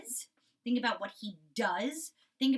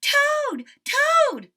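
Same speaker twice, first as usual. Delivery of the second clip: much higher, loud calling; crying out; 14 dB louder than usual. Acted. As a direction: cry out to the sleeper. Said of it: "cried Frog".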